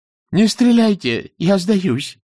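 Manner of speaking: high, loud cry for mercy; frightened scream. A narrator's reading. Monkey begs armed soldiers not to shoot, high scream. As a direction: cry out in a creature's high human voice. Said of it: "закричала обезьяна человеческим голосом".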